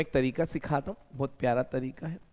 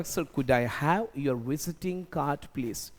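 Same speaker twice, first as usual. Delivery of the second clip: slow and deliberate, each word clear; slow polite request; much slower than usual. Shown as a demonstration. After a first meeting, asking for a visiting card, slow and polite. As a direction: ask for the card slowly and politely. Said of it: "तो मैं बोलूँगा".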